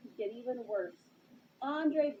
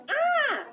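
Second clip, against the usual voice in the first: high, loud startled scream; car scream; 8 dB louder than usual. Acted. Car in the crowd shrieking at the fire, high scream. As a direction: shriek in alarm. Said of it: "a car in the crowd screamed".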